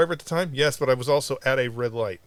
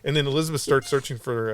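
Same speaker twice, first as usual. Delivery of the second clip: ordinary delivery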